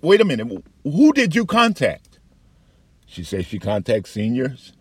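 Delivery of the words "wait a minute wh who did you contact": much higher and louder, slowing on the last words; alarmed question. Retold or imitated. Veteran asking the psychologist whom she contacted, mock alarm. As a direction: rise up in disbelief.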